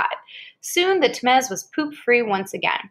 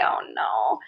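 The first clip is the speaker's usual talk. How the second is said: much lower, drawn-out mock "oh no"; mock dismay; 12 semitones lower, 4 dB quieter than usual. Reacting to the book for a child, mock gloomy groan. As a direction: play it like a gloomy cartoon groan.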